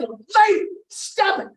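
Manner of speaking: high-pitched yell at a meddler; exasperated shout. Acted. Flustered date tells the lady next table off, high exasperated shout. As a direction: shout her off, voice shooting up. Snaps back at the interfering lady.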